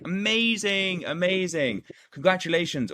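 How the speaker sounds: high, gushing mock praise on each word; overeager gushing. Shown as a demonstration. Coach gushing at a new client, overdone mock enthusiasm. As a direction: gush it out like a too-eager coach. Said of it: "the worst thing you can do is be like".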